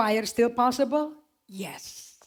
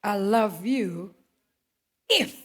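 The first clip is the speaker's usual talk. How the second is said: slow conditional love; much slower than usual. Retold or imitated. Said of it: "kalau dunia ngomongnya kayak begini".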